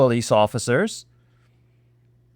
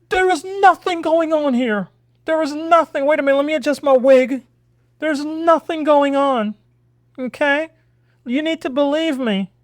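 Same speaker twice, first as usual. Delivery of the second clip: high, mocking politician voice, pitched way up; mock Kamala voice; 10 semitones higher than usual. Vlogger mocking Maxine Waters with a Kamala accent, high and mocking. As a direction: lampoon her denial in a lilting high voice. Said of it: "my Kamala accent came out there".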